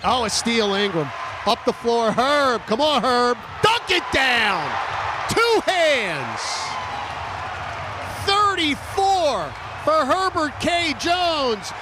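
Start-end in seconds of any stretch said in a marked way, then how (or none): none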